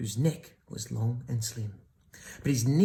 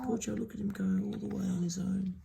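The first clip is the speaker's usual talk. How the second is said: pitying whisper; 6 semitones higher, 4 dB quieter, faster than usual. Real, not acted. Pitying aside to child.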